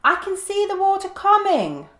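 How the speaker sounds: a cockatoo urging them to hurry; squeaky cockatoo. A narrator's reading. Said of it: "squeaked the cockatoo".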